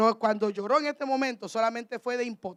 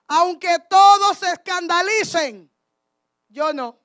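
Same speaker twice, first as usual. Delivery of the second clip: loud, slow, high declaration dropping on the last words; arrogant boast; 4 semitones higher, 7 dB louder, slower than usual. Acted. Peter contradicting Christ at the supper, arrogant boast. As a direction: proclaim it proudly, then pause before the refusal. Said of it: "actuó de una manera arrogante".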